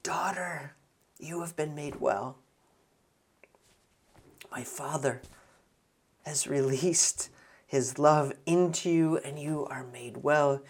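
soft, gentle reassurance; tender, loving. Acted. Jesus to the healed woman, tender and loving. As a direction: speak softly, as to a beloved daughter. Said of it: "so tenderly and lovingly says".

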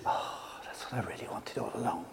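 a soft whispered sigh of relief. Acted. Moses realising what he wanted, whispered relief. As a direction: breathe it out in quiet wonder.

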